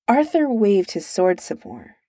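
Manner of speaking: plain reading voice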